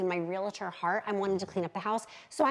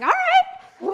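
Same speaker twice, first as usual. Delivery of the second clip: high squeal of delight, much louder; squealed all right; 12 dB louder than usual. Retold or imitated. Recalling his kiss, squealed all right. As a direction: squeak it out, caught off guard and thrilled. Hand on neck, stunned reply.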